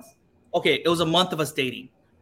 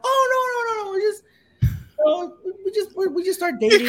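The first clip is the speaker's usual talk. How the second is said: very high, stammering protest; squeaky, hasty brush-off; flustered denial; 13 semitones higher than usual. Retold or imitated. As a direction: backpedal in mock panic. Flustered denial to the shop lady.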